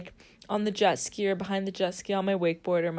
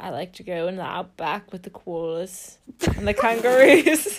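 hammed-up Australian, building louder; Australian accent; 5 dB louder than usual. Pretend outback local talking animals, growing mock Australian accent. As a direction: ham up Aussie till it bursts out. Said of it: "speak with an Australian accent".

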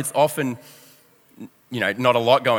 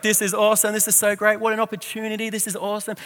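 high-pitched mock celebration, fading softer; excited gushing that trails quieter; celebrating; 7 semitones higher, 4 dB quieter than usual. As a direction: re-enact giddy delight, voice pitched up. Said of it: "We were celebrating".

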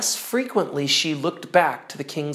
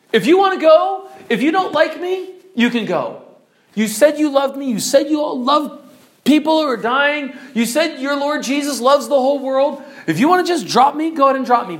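high, raised-voice wounded accusation; acted hurt indignation; angry; 7 semitones higher, 4 dB louder than usual. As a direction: throw her words back at her angrily. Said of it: "He's angry at her".

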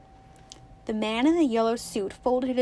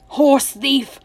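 loud, raised accusing cry; 4 semitones higher, 9 dB louder than usual. A narrator's reading. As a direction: call it out as an angry accusation. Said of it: "cried Tuck".

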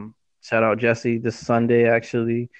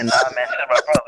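laughing; faster than usual. Real, not acted. Laughing while talking.

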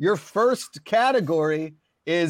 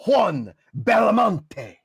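Spanish pronunciation; much slower than usual. Pretend. Host reads Spanish name slowly.

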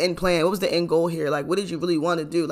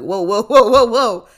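loud, rising string of whoas; alarmed realization; 4 semitones higher, 11 dB louder, slower than usual. Retold or imitated. Storyteller hears friend's theory about the scammer, startled and loud. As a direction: retell it as a startled halt, building louder.

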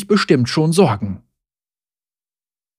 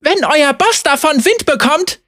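mild shout; 12 semitones higher than usual. A narrator's reading. Zeri threatens the guards, high and half-shouted. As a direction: warn the guards off. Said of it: "schrie sie".

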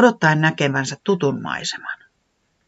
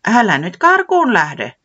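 friendly owl voice; 7 semitones higher, 7 dB louder, slower than usual. Acted. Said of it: "kuului tuttu ääni".

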